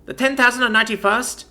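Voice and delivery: defiant shout. A narrator's reading. Commander vows to the envoy never to surrender, shouting. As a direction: hurl the vow at him.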